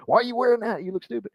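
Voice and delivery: high-pitched, jokey put-down; playful, squeaky rudeness; mock rude remark. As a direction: blurt a silly insult in a high voice. Joke example of what not to say.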